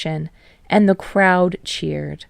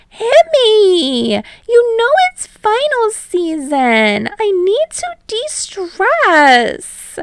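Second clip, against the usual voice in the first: high loud pleading, slowing; dramatic demand; 13 semitones higher, 5 dB louder, slower than usual. Acted. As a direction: beg loudly, drag the end. Mina demanding to play.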